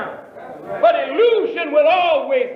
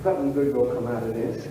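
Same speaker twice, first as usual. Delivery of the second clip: much lower, softer, hurried hopeful musing; preacher voicing a hopeful believer, soft and low; hopeful inner voice; 10 semitones lower, 9 dB quieter than usual. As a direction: muse to yourself, full of quiet hope.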